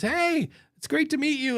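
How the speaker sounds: eager fan. Retold or imitated. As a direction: play the excited fan. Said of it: "somebody comes up and says".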